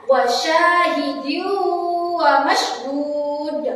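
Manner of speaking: slow "Wasyahidyu wa mashhud" with a held hum into "wa"; drawn-out chant of "Wasyahidyu wa mashhud", no clear n; correct nasal merging. Shown as a demonstration. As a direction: melt the end of "Wasyahidyu" into a long nasal "wa". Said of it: "Jadi yang benar adalah cara membacanya dengan cara memasukkan".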